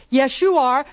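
loud. Shown as a demonstration. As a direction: snap it back loudly. Loud take of repeated line.

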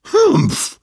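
high, loud scornful snort; scornful humph. Acted. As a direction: snort it out with disdain.

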